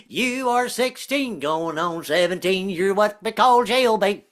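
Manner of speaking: hillbilly Southern twang, slow; sing-song country twang parody; Southern Sound of Music. Pretend. Southern theater troupe doing Sound of Music, slow country twang. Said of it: "Southern versions".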